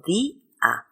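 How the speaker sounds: "di-a" as two slow syllables with a gap; teacher sounding out "di-a" in two beats; split into hiatus. Shown as a demonstration. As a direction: hold the stressed "di", then a separate "a". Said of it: "se pronuncian en dos sílabas diferentes".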